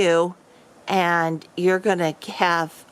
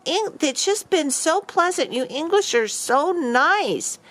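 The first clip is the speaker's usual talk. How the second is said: high, slow, sugary praise of the English; gushing tourist; 8 semitones higher, slower than usual. Retold or imitated. Brother gushing to the pub owner, high and slow. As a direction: lay on the flattery, high and drawn out like a naive visitor. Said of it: "my brother said".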